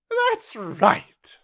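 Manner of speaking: high, loud royal cry; shouted approval. A narrator's reading. Queen answering the soldiers, shouting. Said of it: "shouted the Queen".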